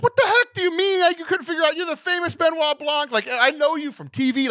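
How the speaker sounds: very high, mock-outraged disbelief; incredulous crowd. Church crowd challenging the famous detective, high incredulous outburst. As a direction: exclaim it way up high, like a baffled crowd.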